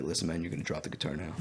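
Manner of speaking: low, soft, controlled threat; changed serious voice. Retold or imitated. Buyer orders the thief to give up the guitar, low and serious. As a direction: drop the voice down and quietly give the order. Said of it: "It just, like, changed".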